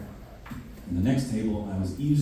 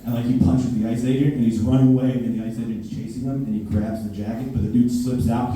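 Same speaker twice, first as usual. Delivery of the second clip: ordinary talk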